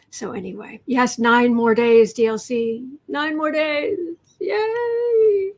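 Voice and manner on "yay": high squealed yay; a thrilled little yay; excited cheer. Real, not acted. Cheering about DLC.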